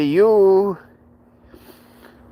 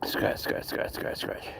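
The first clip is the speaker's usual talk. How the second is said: whisper; 13 dB quieter, slower than usual. Real, not acted. Coaxing dog to scratch, whispered.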